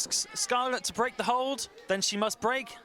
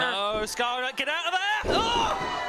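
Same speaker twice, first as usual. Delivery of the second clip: urgent rising plea; excited; 3 semitones higher, much slower than usual. Real, not acted. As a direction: yell a warning to the ring. Commentator cheering her wrestler.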